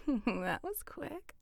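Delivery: ordinary talk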